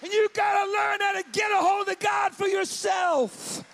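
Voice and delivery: high, loud, ringing preacher's cry; shouted preaching peak. Acted. Pastor rousing his church to seek God, preaching at full force. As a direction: hit the climax with everything you have.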